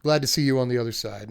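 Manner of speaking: normal talking voice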